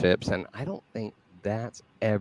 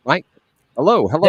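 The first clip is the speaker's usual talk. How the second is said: calling hello into a dead line, louder; testing whether anyone can hear you; 6 semitones higher, 11 dB louder, faster than usual. Real, not acted. Checking if audio works.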